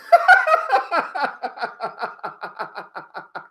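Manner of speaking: drawn-out helpless laughter; real giggling fit at the result; long disbelieving laugh. Real, not acted.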